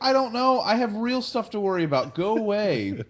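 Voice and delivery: high, loud mock yell of an exasperated engineer. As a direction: shout him off, you are done with him. Geordi brushes Wesley off.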